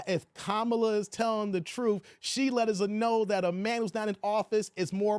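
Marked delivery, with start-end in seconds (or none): none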